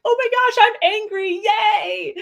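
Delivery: loud, high-pitched mock delight; excited. Coach modeling being excited about anger, excited cheer. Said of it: "be excited, actually".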